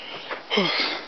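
high, breathy sigh; breathless sigh. Real, not acted. Catching breath after shoveling grandparents' driveway, a breathless sigh. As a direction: exhale hard after the effort.

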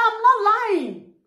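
slow, high and firm; answering accusers, high and slow; firm denial. Real, not acted. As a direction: plant your feet and deny it. Retort to accusers.

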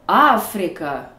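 "Africa" slowly, long loud Aa-frica; stress on first syllable. Shown as a demonstration. Teacher showing stress on a real word, "Africa" stressed at the start. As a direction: hammer the opening A of "Africa". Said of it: "voici comment ça sonne avec de vrais mots".

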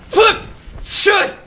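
short, high shouted curses; angry yelling. Real, not acted. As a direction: yell each curse with a blow. Yelling while striking in game.

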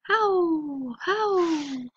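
high, long falling howls; answering wolf howl. A narrator's reading. Unseen wolves answering Moika, high distant-style howls. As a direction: howl high, sliding slowly down. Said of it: "something howled back".

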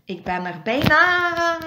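last word stretched into a high, loud wail; high, loud, long-held final vowel; falling cry. A narrator's reading. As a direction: cry out as you drop away. Falling into a well mid-sentence.

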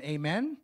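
"Amen" with a rising question at the end. Real, not acted. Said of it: "I said it like a question".

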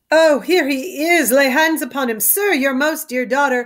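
a courtier who has found the king at last; eager gentleman. Acted. Gentleman finds Lear.

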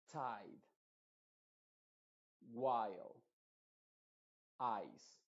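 each word "bite" to "ice" said in English with the gliding "ai" sound, one by one; English long I. Shown as a demonstration. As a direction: model every word with the full English "ai" diphthong, pausing between them. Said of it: "Por ejemplo". (cada palabra en inglés con el diptongo "ai", pausada)

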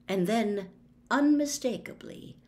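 ordinary delivery, nothing marked

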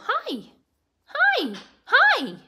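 very high, squeaky little calls; pet-directed greeting. Real, not acted. Owner coaxes pet bird to talk, high-pitched greeting.

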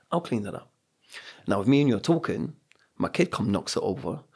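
usual speaking voice

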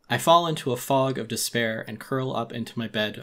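ordinary delivery

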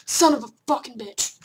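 loud, biting curse; frustrated gamer cursing loudly; angry curse. Real, not acted. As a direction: spit the curse out hard. Raging at the game.

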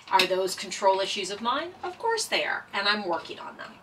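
ordinary talk

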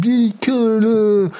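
high, slow hyped rocker; imitating Tommy. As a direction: drawl it like a partying star. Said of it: "He's like".

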